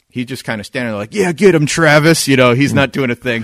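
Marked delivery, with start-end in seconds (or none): 1.1-2.3 loud mock cheer from the sidelines; hammed-up bystander yelling get him; egging on. Bystander cheering on the drunk attacker, a loud mock yell.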